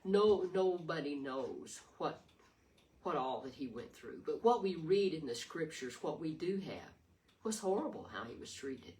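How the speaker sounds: normal talking voice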